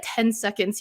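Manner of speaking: usual speaking voice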